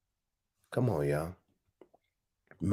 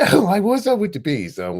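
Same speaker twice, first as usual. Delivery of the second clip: higher, louder, breaking into laughter; laughing; 5 semitones higher, 6 dB louder than usual. Retold or imitated. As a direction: laugh your way through the punchline. Repeating the joke line laughing.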